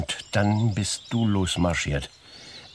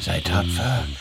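ordinary talk, nothing marked

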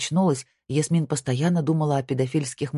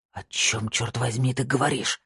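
ordinary delivery

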